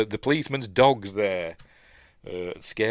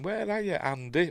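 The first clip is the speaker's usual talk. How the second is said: higher voice, imitating the heroine calling; mock searching call; 8 semitones higher than usual. Woman searching for Andy, mock calling. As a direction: call out as if looking for someone.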